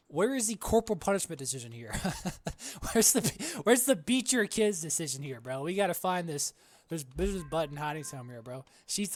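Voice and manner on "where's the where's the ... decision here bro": higher, louder gleeful search; giddy gamer outburst; excited joking. Real, not acted. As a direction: hunt for the button with glee.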